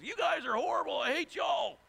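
high, hammed-up angry yell; frustrated shout. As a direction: play an exasperated Moses blowing up. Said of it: "my amplified version".

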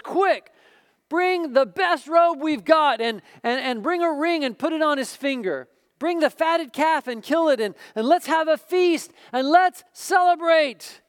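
high, loud joyful orders to the servants; a father calling out in delight. Acted. Father orders feast for son.

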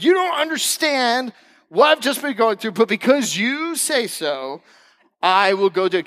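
high protest slowing into grudging consent; exasperated protest. Acted. Peter reluctantly obeying Jesus, exasperated then resigned.

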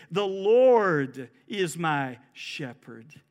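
slow, drawn out, each word weighed; slow emphatic verse. Shown as a demonstration. Preacher to congregation, slow weighty verse. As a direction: lean on the Lord, slowly.

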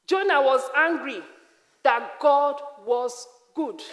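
loud, emphatic sermon delivery; full-force preaching. Acted. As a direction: drive the point home to the congregation.

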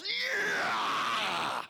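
loud, high strained yell of effort; gym grunt yell. Retold or imitated. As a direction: bellow it like lifting a huge weight. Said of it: "he's screaming".

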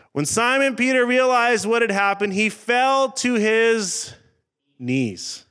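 slow the words down to land the fall; dramatic scripture reading. Acted. Pastor reading Luke to the congregation, slowed and dramatic.

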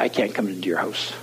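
low, soft mumbled refusal; subdued reply. Retold or imitated. Shabby young man declining the homeowner's invitation, low and subdued. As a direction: imitate a reluctant guest, dropping low and soft.

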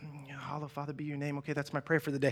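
low, quiet mumbled rote prayer; perfunctory scripted prayer. Acted. Preacher mimics a drive-by morning prayer, perfunctory. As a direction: toss off a prayer just to be done. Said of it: "that scripted prayer that you have".

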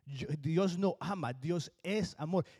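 ordinary talk, nothing marked

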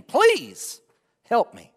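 loud pleading cry, pitch leaping up on please; desperate begging cry. Acted. Preacher crying out a plea to God.

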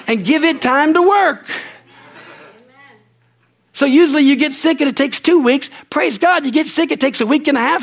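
high-pitched, emphatic sermon delivery; fervent preaching. Real, not acted. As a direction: preach it with rising fervour. Preaching at heightened force.

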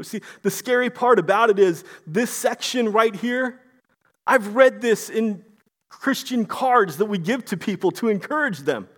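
ordinary talk, nothing marked

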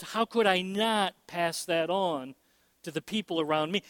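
ordinary talk

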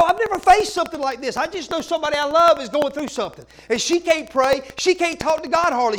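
ordinary talk

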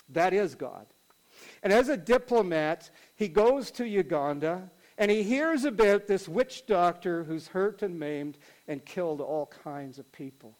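usual speaking voice

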